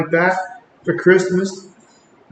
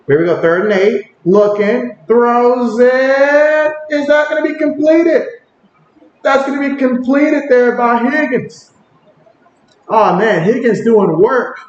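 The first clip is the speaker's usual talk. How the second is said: higher, rising call as the pass completes; excited play-by-play; 7 semitones higher than usual. Acted. Streamer calling the Higgins catch to chat, excited play-by-play. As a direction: sell the big catch like a broadcaster.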